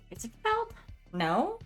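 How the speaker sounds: ordinary delivery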